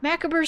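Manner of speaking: "Macabre" as MACK-uh-ber; front-stressed "Macabre" with a sounded ber; preferred mispronunciation. Shown as a demonstration. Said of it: "Why is it not macabre".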